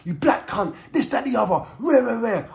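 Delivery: high-pitched retold angry rant; high, indignant mock tirade; lost temper. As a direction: act out losing your temper, voice jumping up. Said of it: "I just lost my temper".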